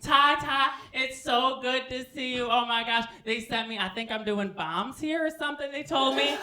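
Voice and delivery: high, loud, gushing friend; Chevy. Acted. Chevy greets Ty and reports the new job, gushing and high. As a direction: gush high and loud at an old pal. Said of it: "He's like".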